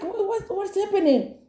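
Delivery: very high, flustered stammer; mock panic. Narrator recalling castmate hitting the table, high flustered stammer.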